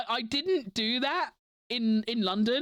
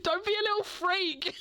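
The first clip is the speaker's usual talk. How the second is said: giggling through the words, pitched up; giggly mock jab; laughing mock quote; 6 semitones higher than usual. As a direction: laugh it out as a teasing friend. Imagined friends' mocking remark.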